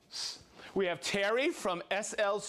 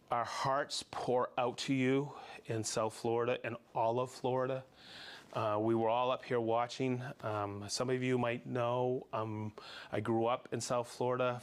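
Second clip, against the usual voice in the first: lower, softer, heartfelt words to those hit; sincere comfort; 7 semitones lower, 3 dB quieter than usual. Real, not acted. Host sending heartfelt wishes to Florida viewers, soft and sincere. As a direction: speak gently to people going through a storm.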